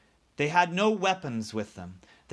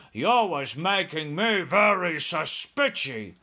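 suspicious giant, voice raised; giant's bellow; 4 dB louder than usual. A narrator's reading. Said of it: "he bellowed".

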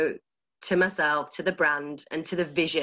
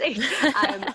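laughing; 5 semitones higher, 3 dB louder than usual. Real, not acted. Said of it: "it is just hilarious".

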